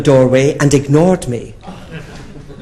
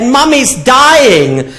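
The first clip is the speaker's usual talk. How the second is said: put-on high, slow worried child; alarmed little boy; 11 semitones higher, 4 dB louder, much slower than usual. Nephew telling his aunt alarming news, high child voice. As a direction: say it wide-eyed and scared.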